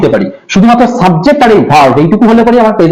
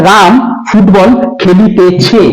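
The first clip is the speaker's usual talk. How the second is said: slow demo; much slower than usual. Shown as a demonstration. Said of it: "ভার্বের শেষে লাস্টে ই থাকবে".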